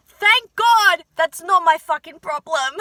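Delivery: loud, slow, heartfelt relief; loudly relieved and thankful; emphatic gratitude. Acted. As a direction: belt out thanks, drawing out the words. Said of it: "I'm so grateful".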